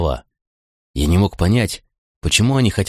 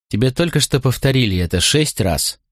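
higher-pitched, mildly cross reproach; angry; 5 semitones higher than usual. A narrator's reading. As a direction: scold with a touch of worry underneath. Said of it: "сердито сказала Старлинг". (сердито, с тревогой в голосе)

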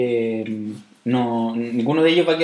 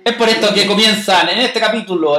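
announcer voice; 5 semitones higher, 4 dB louder than usual. Pretend. Announcing the new segment.

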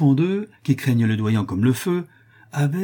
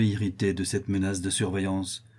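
ordinary delivery, nothing marked

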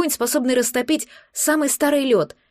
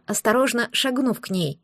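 plain reading voice

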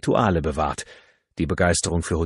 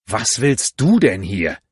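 loud, slow, aghast question; drawn-out, appalled question; startled outcry; 4 semitones higher, 6 dB louder than usual. A narrator's reading. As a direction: cry it out in shock at the visitor. Shocked cry at unexpected visitor.

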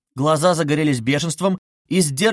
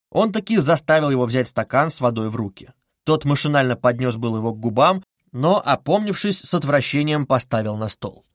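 usual speaking voice

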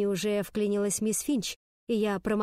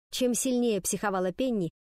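usual speaking voice

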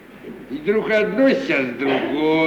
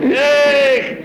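high, loud rueful "Эх"; a heavy-hearted exclamation; sighing lament; 9 semitones higher, 6 dB louder than usual. Acted. Old servant lamenting over the young master, high rueful sigh.

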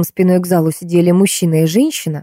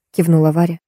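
plain reading voice

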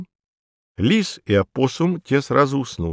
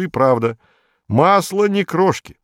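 ordinary delivery

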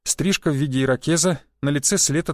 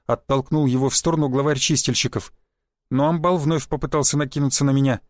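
ordinary delivery, nothing marked

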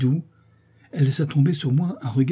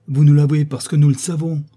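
loud, higher-pitched rebuke; sharp retort; 4 semitones higher, 8 dB louder than usual. A narrator's reading. Adélaïde rebuts her brother's late confession, loudly indignant. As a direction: throw the confession back at him.

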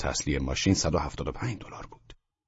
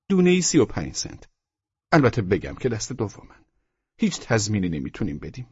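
higher, louder shop seller; emphatic, pitched-up salesman; salesman character; 4 semitones higher, 3 dB louder than usual. Acted. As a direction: rattle off the sales terms in a raised voice. Seller gives bird prices.

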